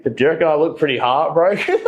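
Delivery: quick, high, mock-innocent; sarcastic question. At the Masters with a beer, mocking the heartbroken headline. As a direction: tease them about the headline.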